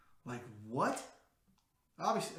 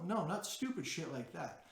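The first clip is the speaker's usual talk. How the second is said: ordinary talk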